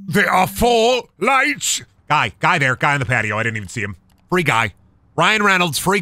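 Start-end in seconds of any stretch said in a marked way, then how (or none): 0.1-1.8 slow, high shouted proclamation; dramatic quote. Retold or imitated. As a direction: declaim it like a defiant captain. Quoting Picard line, shouted.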